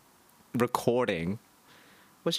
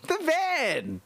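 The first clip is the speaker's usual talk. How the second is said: squealed out, much higher; excited squeal. Real, not acted. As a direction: shout it out, thrilled and high. Excited repeat of answer.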